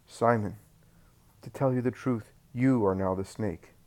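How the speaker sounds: plain reading voice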